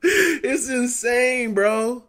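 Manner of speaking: slow, high, drawn-out laughing disbelief. Real, not acted. Laughing in disbelief at play calling.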